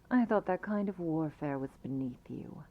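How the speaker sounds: ordinary talk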